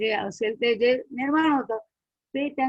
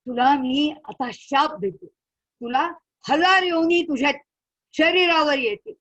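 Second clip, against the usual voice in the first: loud curse; 4 semitones higher, 6 dB louder than usual. Acted. Sage curses Indra.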